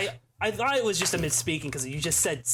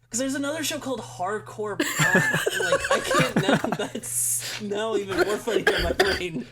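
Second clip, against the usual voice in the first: breaking up with laughter mid-sentence; laughing; 6 semitones higher, 4 dB louder than usual. Real, not acted. Friend cracks up telling others a show's name, laughing. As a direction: talk through real laughter, higher and louder.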